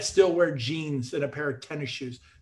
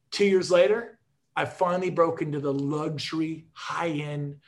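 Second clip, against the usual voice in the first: ordinary talk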